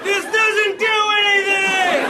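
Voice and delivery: very high, slow comic shout; exasperated shout. Retold or imitated. Kid stuck with the gym parachute, shouting. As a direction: rant at the useless parachute. Ranting about useless parachute.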